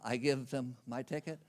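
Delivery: usual speaking voice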